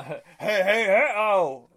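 high loud cartoon hey hey hey; loud cartoon catchphrase; Fat Albert impression. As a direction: call it out like the cartoon. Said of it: "that's Fat Albert's line".

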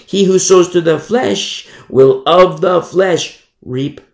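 loud, slow verse reading, flesh punched each time; stress on flesh. Shown as a demonstration. Preacher repeats the verse on sowing, emphasis on flesh. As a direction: lean hard on every "flesh". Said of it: "emphasis on the flesh".

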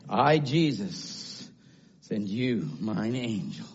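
low, soft, slow scripture quote; hushed reverence. Real, not acted. Preacher quoting Jesus to the church, hushed and reverent. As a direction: drop right down and let it sink in.

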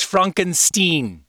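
"Frankenstein" said as FRONK-en-steen; quoted odd pronunciation. Acted. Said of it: "It's pronounced".

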